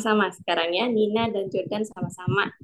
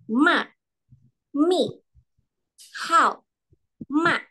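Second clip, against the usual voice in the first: sharp falls, higher and louder; mock-angry falling fourth tone; 6 semitones higher, 3 dB louder, slower than usual. Shown as a demonstration. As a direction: jerk each syllable down hard. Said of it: "nadanya memang disentak".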